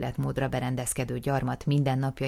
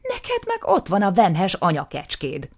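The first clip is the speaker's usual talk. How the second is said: slow, loud comeback; sharp counter; 6 semitones higher, 9 dB louder than usual. Acted. Nisim countering his brother, slow and loud. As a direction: hit back word by word. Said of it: "kontrázott Nisim".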